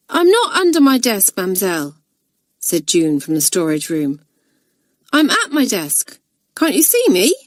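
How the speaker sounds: innocent schoolgirl voice. Acted. June teasing from the storeroom.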